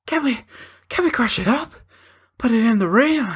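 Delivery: high, pleading mock voice; mocking the husband. Husband begs the priest to use the mood ring, high and pleading. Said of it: "it's like".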